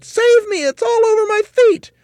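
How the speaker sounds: very high, loud frantic shriek; screaming. A narrator's reading. Mrs. Twit feels the creature on her feet, screaming. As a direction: shriek for rescue in full panic. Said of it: "screamed Missus Twit".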